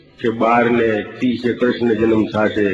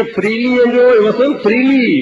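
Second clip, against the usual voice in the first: excited cheer; 10 semitones higher than usual. Retold or imitated. Friend cheering him at pool.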